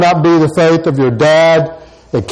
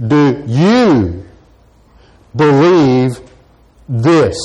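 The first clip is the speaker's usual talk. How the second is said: YOU drawn out with a big rise and fall, then a pause; stress on YOU; 3 semitones lower, much slower than usual. Shown as a demonstration. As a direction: lean hard on "you", then set "believe" and "this" apart. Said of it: "the emphasis would be".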